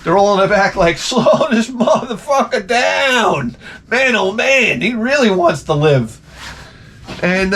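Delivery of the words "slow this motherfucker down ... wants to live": voicing the lions. Lions voiced laughing in back.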